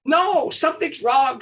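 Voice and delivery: high, loud, drawn-out protest; urgent objection. Retold or imitated. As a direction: cry out slowly that something is amiss. Preacher's alarmed interruption, retold.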